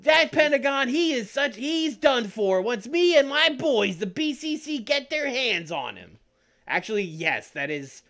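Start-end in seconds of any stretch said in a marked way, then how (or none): 0.0-6.2 high, loud, hyped influencer promo; YouTuber voice. Retold or imitated. Building a Sammy Guevara impression as a YouTuber, high and loud. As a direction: sell the threat like a vlogger hyping a video. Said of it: "YouTuber voice".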